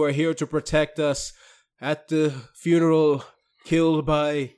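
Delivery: slow, droning liturgical delivery; priest praying. Acted. Holy priest praying at a funeral, slow chanting intonation. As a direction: intone it solemnly, rising into a held chant at the end. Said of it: "the holy priest of the Emperor is there saying prayers".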